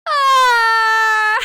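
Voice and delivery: high, loud anguished cry; anguished scream. Acted. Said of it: "my voice is dying".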